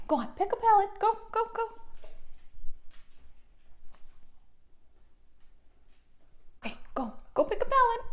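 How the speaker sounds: very high excited pet talk; dog voice. Real, not acted. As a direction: cheer the dog on, high and sweet. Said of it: "I do use my dog voice in this video".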